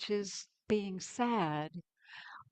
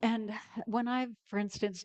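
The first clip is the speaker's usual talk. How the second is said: ordinary talk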